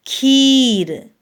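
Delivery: खीर with the ई stretched long and slow; long vowel drawn out. Shown as a demonstration. Teacher shows pupils the long vowel, stretching खीर. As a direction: drag out the ई in खीर for a few seconds. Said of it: "उसको थोड़ा सा खींचते हैं कुछ second के लिए".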